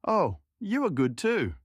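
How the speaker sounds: put-on high, slow offended old woman. The cook woman snaps back at the grey-haired Cossack, high and slow. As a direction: throw the jibe back at him.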